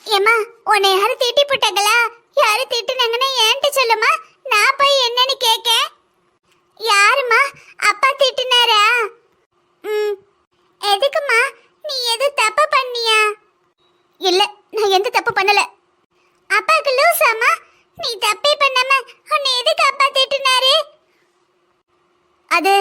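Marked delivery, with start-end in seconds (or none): 0.1-13.2 put-on high child voice, questioning mother; higher-pitched little child asking who scolded her; concerned child. 16.5-20.7 put-on high, puzzled child voice; higher-pitched child questioning dad's scolding; indignant child. Child indignant on mother's behalf.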